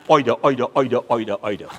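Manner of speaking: Bajan "either" as OY-duh, repeated; Bajan accent. Acted. Bajan man settling the argument his way, exaggerated Bajan accent. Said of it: "Hear the Baj man".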